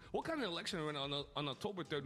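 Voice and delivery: ordinary delivery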